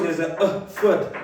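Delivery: usual speaking voice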